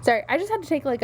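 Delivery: ordinary talk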